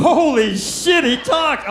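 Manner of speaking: loud, slow astonished yell; loud amazed shout. Retold or imitated. Hunter stunned the parrot talks, loud amazed shout. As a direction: blurt it out in disbelief.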